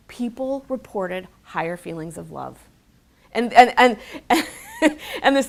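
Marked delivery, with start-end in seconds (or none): 3.3-5.5 laughing. Real, not acted. Laughing at the viral story.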